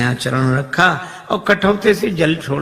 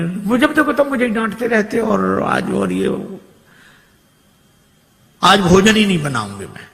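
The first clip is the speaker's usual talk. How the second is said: higher, hammed-up complaining wife; mock scolding wife; 6 semitones higher than usual. Wife fakes a quarrel so the guest overhears. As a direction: play the wife faking a quarrel.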